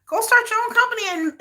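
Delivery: high sassy taunting voice; mock them in a high sneering voice. Mocking repeat of the taunt.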